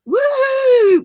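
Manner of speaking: high, loud "woo!"; excited whoop. Real, not acted. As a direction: let out a long, high-pitched whoop. Livestream host whoops at viewer chat.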